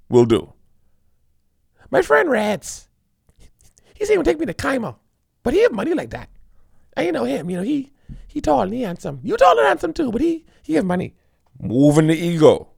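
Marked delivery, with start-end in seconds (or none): 1.9-11.1 butter him up while needling; sweet-talking woman. Acted. Woman praising a rich friend to a dude, coaxing. Woman playing on a man's ego.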